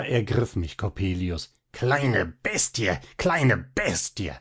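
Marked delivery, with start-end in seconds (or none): 1.7-4.3 slow, sneering bleat; mock a child like a cruel old goat, slowly; snarling taunt from a villain; bleating through bared teeth. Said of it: "meckerte er zähnefletschend".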